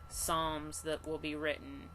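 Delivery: ordinary talk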